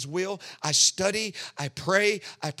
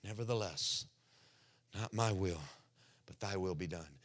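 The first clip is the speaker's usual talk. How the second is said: much softer, lower surrender; a quiet prayer of surrender; hushed prayer; 9 semitones lower, 12 dB quieter than usual. Retold or imitated. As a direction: breathe the prayer almost silently. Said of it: "if you can learn".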